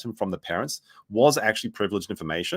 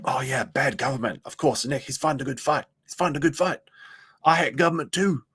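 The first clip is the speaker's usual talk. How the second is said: mock fan; 3 semitones higher than usual. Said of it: "They're just like".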